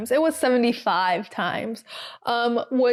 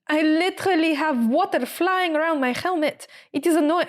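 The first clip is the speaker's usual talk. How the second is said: higher-pitched, badly faked French accent; attempted French accent; 6 semitones higher than usual. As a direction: imitate a French driver complaining, accent wobbly. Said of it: "I can't do a French accent".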